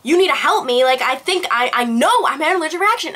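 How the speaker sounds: urgent plea. Retold or imitated. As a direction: plead for help, acting out the urgency. Retelling her urgent plea to mom.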